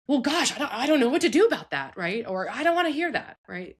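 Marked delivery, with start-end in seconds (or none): 0.1-2.0 high, quick mock helplessness; flustered parent. Voices an overwhelmed, rejecting parent. 2.5-3.4 high-pitched mock refusal; shutting-out parent. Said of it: "showing them that you're shutting out".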